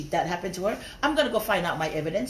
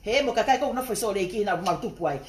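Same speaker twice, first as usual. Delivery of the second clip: ordinary talk, nothing marked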